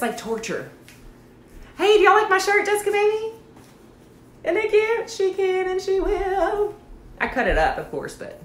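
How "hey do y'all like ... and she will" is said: cutesy singsong. Real, not acted. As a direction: show it off in a high singsong. Showing off her shirt playfully.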